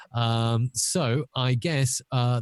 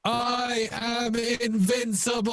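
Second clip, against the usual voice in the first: high, slow robotic monotone; effected Dalek villain voice; 13 semitones higher, slower than usual. Acted. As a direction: stretch it out like an electronic Dalek. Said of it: "this is the Dalek Bond villain".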